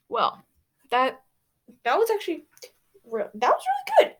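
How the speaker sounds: gushing. Real, not acted. Reader reacting to the story, thrilled gush. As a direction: squeal the praise. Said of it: "I gotta admit".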